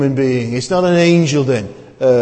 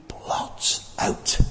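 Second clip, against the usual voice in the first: whisper; 12 dB quieter than usual. Real, not acted. Stressing God's forgiveness.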